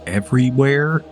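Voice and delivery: normal talking voice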